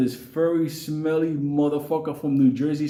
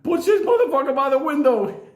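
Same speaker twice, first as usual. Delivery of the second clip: high, urgent retold holler; urgent yelled order; 10 semitones higher, 4 dB louder than usual. Imitating Benny ordering the baboon out, urgent high yell. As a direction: holler it like a panicked command. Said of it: "fucking Benny said".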